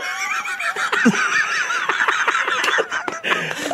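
long high cackling laugh; wild rambling cackles. Real, not acted. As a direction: cackle wildly at the joke. Long laugh after bit.

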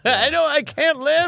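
high, laughing whine; high mock wail.

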